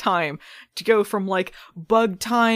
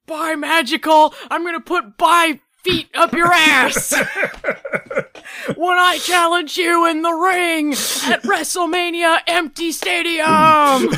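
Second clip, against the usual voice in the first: wrestling promo; 9 semitones higher, 6 dB louder than usual. Pretend. Answers with own wrestling promo.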